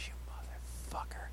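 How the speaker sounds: barely voiced, hushed imitation; whispered mock curse. Friend points at the host on set, whispered mock curse. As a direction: whisper it like a private greeting. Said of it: "he points and he goes".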